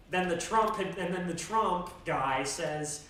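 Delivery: ordinary delivery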